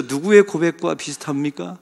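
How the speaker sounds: ordinary delivery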